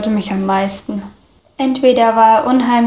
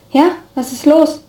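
startled, pitched up, confused question; 5 semitones higher than usual. A narrator's reading. Student jolted from a trance, confused and pitched up. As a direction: snap out of a daydream, bewildered. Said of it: "fragte ich verwirrt".

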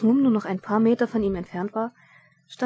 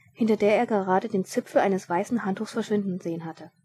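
ordinary delivery

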